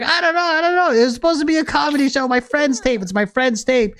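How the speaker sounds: high, hurried mock pleading; high-pitched defensive protest; panicked excuses. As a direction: babble excuses like a busted kid. Son makes excuses to dad.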